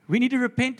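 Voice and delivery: forceful repeated call to repent. Acted. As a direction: hit it harder the second time, loud and high. Preacher repeating the call louder.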